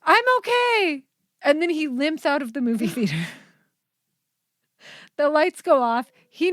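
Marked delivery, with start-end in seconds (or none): 0.0-1.0 high, slow, loud insistence; man who fell down the stairs, high and slow; mock brave reassurance. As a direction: chirp it like nothing happened. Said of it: "and he goes".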